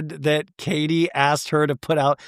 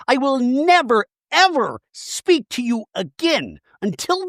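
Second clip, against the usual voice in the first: Shannon impression; 8 semitones higher than usual. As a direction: lay down the law like a dramatic housewife. Shannon's dramatic declaration.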